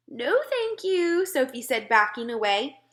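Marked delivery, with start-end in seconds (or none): 0.1-1.3 put-on high, slow girl refusing; polite refusal, higher pitch; little girl voice.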